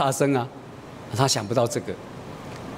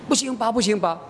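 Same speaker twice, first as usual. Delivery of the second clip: put-on little girl; 7 semitones higher than usual. Daughter hides behind mother.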